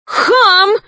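shrill high shouted insult; shouting. Acted. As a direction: shriek it high and loud. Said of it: "крикнула белая ворона".

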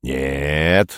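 put-on low, drawn-out elderly refusal; old man. A narrator's reading. Shrewd old man doubts Perets, low and drawn out. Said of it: "сказал проницательный старик".

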